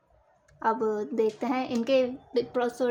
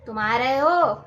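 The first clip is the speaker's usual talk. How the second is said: louder, sharp angry demand; 7 dB louder than usual. Shown as a demonstration. Teacher demonstrating anger to students, loud and angry. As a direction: snap it at someone who annoyed you. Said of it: "गुस्से में".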